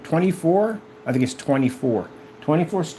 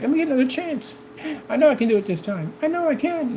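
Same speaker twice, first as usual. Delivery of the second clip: higher begging mock voice; mock failed singer pleading; 10 semitones higher than usual. Failed singer begging judges for another chance, mock pleading. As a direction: plead like a rejected contestant.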